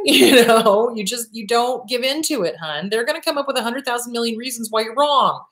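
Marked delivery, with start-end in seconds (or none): none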